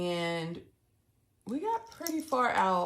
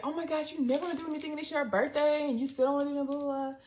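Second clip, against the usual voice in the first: whiny sister nag; 6 semitones higher, much faster than usual. Retold or imitated. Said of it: "She's like".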